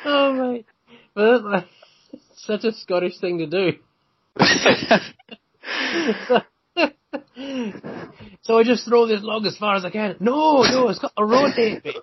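high-pitched, hammed-up Scottish accent; bad Scottish accent. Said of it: "forgive me for my horrendous Scottish accent".

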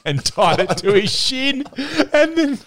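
high, drawn-out telling through laughter; real amusement. Real, not acted. Teammate telling the yellow bib story to friends, laughing. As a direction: crack up while telling it, pitch soaring.

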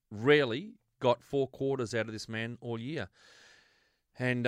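normal talking voice

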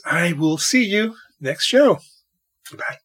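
ordinary delivery

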